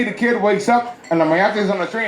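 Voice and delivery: ordinary talk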